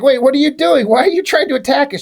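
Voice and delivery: mock alarm, quick and high; alarmed protest. Prince to the witch running out with a knife, alarmed.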